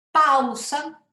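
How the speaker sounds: Pausa with AU fused in one loud beat; diphthong as one syllable. Shown as a demonstration. Teacher showing a diphthong, strong and weak vowel fused. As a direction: punch PAU in Pausa as a single syllable. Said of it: "entonces es una sola sílaba".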